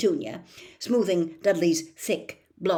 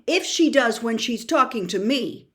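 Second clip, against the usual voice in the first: bitter; 6 semitones higher, 6 dB louder than usual. A narrator's reading. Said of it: "said Harry bitterly".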